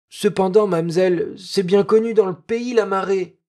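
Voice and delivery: put-on a village boy patiently explaining the tide; earnest peasant boy. Peasant boy explaining the tide to Noémie, earnest child voice.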